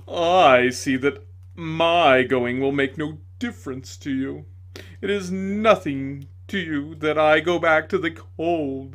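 loud, slow, wallowing lament; milk the pity, stretch the lonely; self-pitying tragedian. A narrator's reading. Tragedian bemoaning his fate to the lady, slow loud lament.

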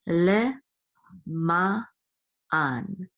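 "Le", "ma", "an" each said alone with pauses; broken-up teaching pronunciation of "Le ma an"; syllable by syllable. Shown as a demonstration. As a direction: sound out "Le ma an" slowly in separate pieces. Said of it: "so it says an".

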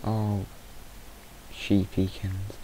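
slow, fond cooing; slow baby-talk to a sheep. Acted. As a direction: coo it like to a pet.

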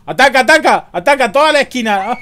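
high panicked yelling with laughs; panicking gamer. Real, not acted. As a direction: scream orders in panic. Monster attack, player yells.

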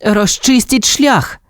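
high, slow, deliberate order; commanding. A narrator's reading. Boy orders pups to clear road, commanding. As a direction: call out the command, stretching each word. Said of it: "скомандував хлопець".

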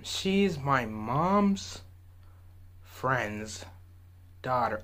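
very slow, word by word; slow and confused. Retold or imitated. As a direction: drag it out, puzzling it over. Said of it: "He made it sound way more confusing".

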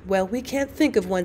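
soft, tired and worn out; wearily. A narrator's reading. Janetta to Egbert, giving up on the letter, softly weary. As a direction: sigh the words out, out of ideas. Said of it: "said Janetta wearily".

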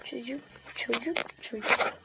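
whispered amazement; hushed whisper. Real, not acted. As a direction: whisper it while watching. Watching a kid fall.

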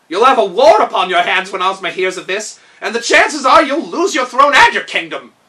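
warning steward. Acted. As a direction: loudly warn the king of war. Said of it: "remarked Kaliko".

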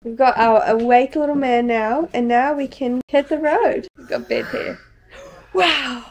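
ordinary talk, nothing marked

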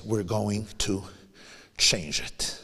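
soft, low, hushed promise; quiet resolve. Retold or imitated. Rabbi quoting his late friend's vow, hushed and soft.

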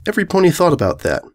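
usual speaking voice

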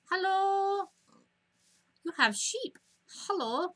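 sweet high greeting to villagers; high cutesy greeting. Pretend. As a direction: coo at the villagers.

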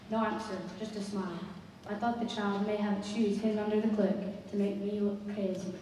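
usual speaking voice

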